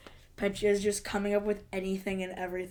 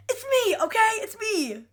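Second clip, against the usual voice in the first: high, loud mock snap of frustration; mock angry outburst; 13 semitones higher, 4 dB louder than usual. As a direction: burst out like Natalie losing patience. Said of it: "Natalie's just building up with anger".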